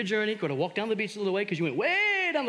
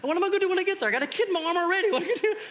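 higher, faster, worried self-questioning; anxious inner panic; 10 semitones higher, 4 dB louder, much faster than usual. Retold or imitated. Preacher recalling his own panic, higher and rushed. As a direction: rattle off your worries while swimming. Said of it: "I'm trying to get to him".

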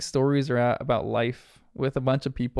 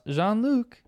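pitched-up retold shout; mock shout; 8 semitones higher than usual. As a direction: imitate a child calling for a friend.

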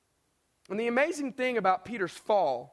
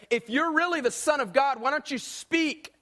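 higher-pitched mock heckle, slowing on the challenge; acted taunting insult; 5 semitones higher than usual. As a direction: jeer like a heckler demanding an answer. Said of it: "to hurl insults at him and say".

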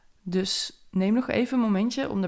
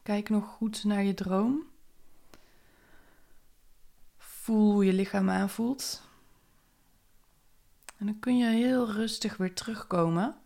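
guided meditation. Acted. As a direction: guide the listener gently inward.

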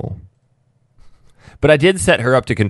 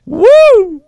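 cheering; 11 dB louder than usual. Real, not acted.

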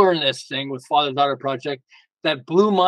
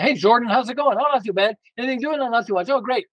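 quick upbeat phone banter; 7 semitones higher than usual. Retold or imitated. Acting out short call with son.

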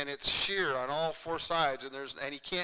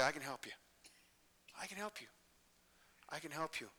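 very soft, hushed offer of help; gentle reassurance; 13 dB quieter than usual. Acted. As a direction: offer help softly, letting each phrase settle. Voicing a friend offering help.

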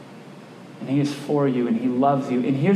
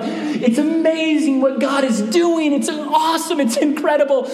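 higher and thrilled, with a laugh at the end; excited gushing; 9 semitones higher than usual. Retold or imitated. Telling his dad on the phone about the church's growth, excitedly. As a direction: gush about the good news.

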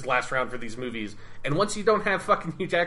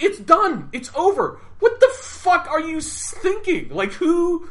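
much higher, louder disbelieving rant; real exasperation, pitched way up, laughing at the absurdity; shocked, indignant outburst; incredulous outrage; 13 semitones higher, 5 dB louder, slower than usual. Real, not acted. Ranting over the abuse backstory.

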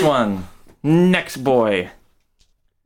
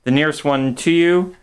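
ordinary talk, nothing marked

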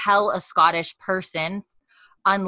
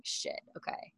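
a defeated breath of a curse, very soft; resigned whisper; 15 dB quieter than usual. Retold or imitated. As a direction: give in under your breath. Resigned after pausing to think.

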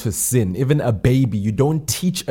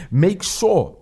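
higher emphatic call; 7 semitones higher than usual. Acted. Repeated "make sure", higher.

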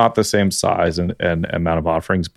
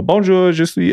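stiff anglophone French; 10 semitones higher than usual. Retold or imitated. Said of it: "if you speak French like me".

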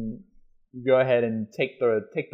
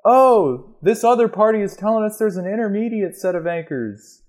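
high-pitched mock call down to a climber; shouting back; 9 semitones higher than usual. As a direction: pretend to holler from up above. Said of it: "they're gonna shout back".

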